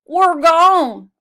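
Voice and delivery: loud horse whine; whining horse. Acted. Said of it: "whined Mister Brown".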